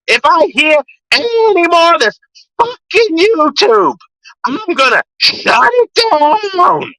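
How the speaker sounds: mock his rant, drawing out the last threat and yelling it; Danny's voice. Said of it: "That's how Danny talks, I swear to God".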